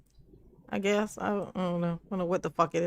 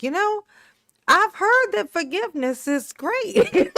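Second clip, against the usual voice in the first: slow, much higher mock voice of the shooter; mock victimizer voice; 11 semitones higher, 5 dB louder than usual. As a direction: ham up the shooter preaching forgiveness. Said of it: "be like".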